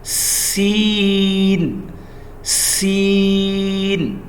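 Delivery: سین stretched very slowly; drawn out seen. Shown as a demonstration. Teacher drills the letter سین slowly. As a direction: hold سین long and slow. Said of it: "اور next one, سین".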